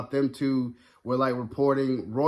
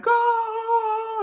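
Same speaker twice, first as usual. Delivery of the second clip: much higher hyped yell of Go!; excited shout; 4 dB louder than usual. Retold or imitated. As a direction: shriek it like a thrilled fan. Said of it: "I just love when he was like".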